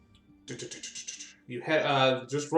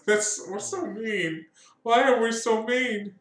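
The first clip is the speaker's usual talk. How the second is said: mock guilt; 8 semitones higher, slower than usual. Real, not acted. Mock guilt while laughing.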